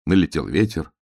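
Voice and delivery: usual speaking voice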